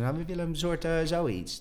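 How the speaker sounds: mock director. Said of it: "en zeg". (hoge imitatie van de regisseur)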